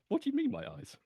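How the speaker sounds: high-pitched, taken-aback question; baffled surprise. Acted. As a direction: ask it as if wholly bewildered. Character baffled by remark on eyes.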